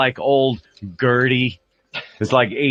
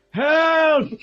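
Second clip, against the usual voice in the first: a far higher, strained cry of help; old man yelling. Retold or imitated. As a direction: shout it like a lost old man calling from down the hall. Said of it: "he just yells help for nothing".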